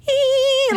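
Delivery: sharp high squeal of panic. Retold or imitated. Losing control of the gears downhill, high squeal. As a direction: let out a high eek.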